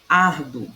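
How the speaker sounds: "Ardo", leaning hard on AR; stress on AR. Shown as a demonstration. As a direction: hit the AR of "Ardo". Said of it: "penúltima sílaba é ar".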